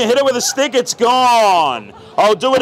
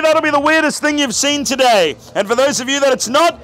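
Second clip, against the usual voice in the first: usual speaking voice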